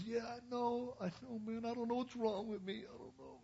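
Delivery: very high, whimpering excuse-maker; whiny wimp. Retold or imitated. A wimp confessing to his counselor, whiny. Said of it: "he's just a wimp".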